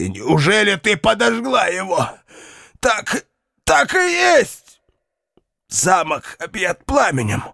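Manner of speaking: raging, high and slow; furious. Acted. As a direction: cry out in fury. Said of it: "воскликнул вне себя от ярости".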